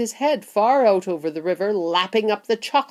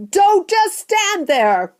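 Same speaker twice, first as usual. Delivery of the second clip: high, slow, loud demand; frantic, drawn-out shriek; scream; 13 semitones higher, 6 dB louder, slower than usual. A narrator's reading. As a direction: scream at your husband to act. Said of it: "Missus Gloop screamed at Mister Gloop".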